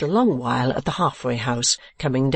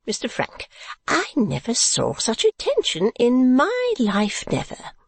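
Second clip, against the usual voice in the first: high, swooping matronly chatter; chatty, effusive mother; 8 semitones higher than usual. A narrator's reading. Mother praising a young man to Kate, effusive. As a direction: gush on in a high voice.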